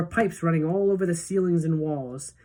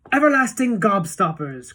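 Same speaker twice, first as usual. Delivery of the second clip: higher, louder proud announcement; proud cry; 4 semitones higher, 6 dB louder than usual. A narrator's reading. Wonka shows the children his sweets, proud cry. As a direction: show off his invention. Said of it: "cried Mister Wonka proudly".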